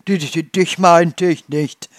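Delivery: stuttering loudly through laughter; breathless stammer. A narrator's reading. Farmer stammers to his wife, breathless with laughter. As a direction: laugh and trip over the first word. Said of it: "stammelte er atemlos".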